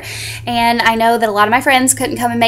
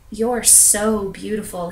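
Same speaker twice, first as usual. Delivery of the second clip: slow, hushed admiration; breathy awe; 2 semitones lower, 10 dB quieter, much slower than usual. Acted. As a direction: say it slowly, lost in wonder. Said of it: "He got breathy".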